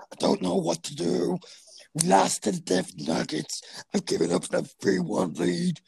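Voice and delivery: lightly put-on rough coach voice, mock lament; imitating Doc Rivers. Podcaster mocking the coach's blown lead, mock-defeated voice. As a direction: play a defeated coach with a hint of a croak.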